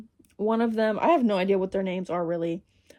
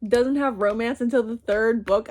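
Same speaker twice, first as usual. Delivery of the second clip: normal talking voice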